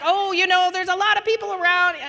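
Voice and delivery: high, loud breezy brush-off; hammed-up casual; mock casual disciples. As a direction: play it down like a shrugging disciple. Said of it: "They don't talk like this".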